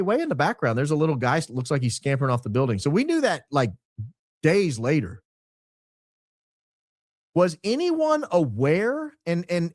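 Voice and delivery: ordinary talk, nothing marked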